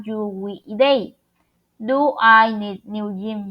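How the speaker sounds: ordinary talk, nothing marked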